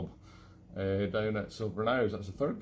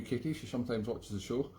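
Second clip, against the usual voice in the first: normal talking voice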